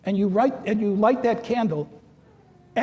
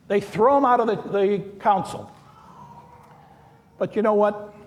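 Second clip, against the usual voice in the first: ordinary talk, nothing marked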